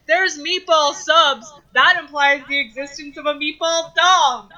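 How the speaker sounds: retold yell. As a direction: mimic a friend barging in loudly.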